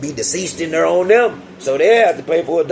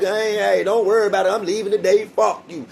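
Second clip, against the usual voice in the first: higher, dismissive and breezy; brushing him off; 7 semitones higher than usual. Retold or imitated. Inmate brushing off the guard on the way out, higher. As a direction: wave him off, pitched up. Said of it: "I'm like".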